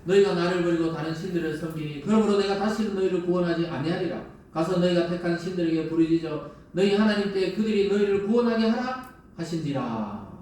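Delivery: loud, solemn reading of God's words; scripture reading. Acted. Preacher reading Judges to the congregation, loud and solemn. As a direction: proclaim the verses with weight.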